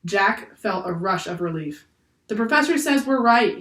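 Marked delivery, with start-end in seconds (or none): 2.3-3.6 higher, louder call; yelled appeal. A narrator's reading. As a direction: yell it so all hear. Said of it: "yelled Annie".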